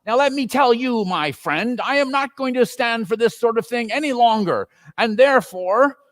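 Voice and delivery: put-on old boss hamming up a rebuke; mock stern Scrooge. A narrator's reading. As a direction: pretend to be angry.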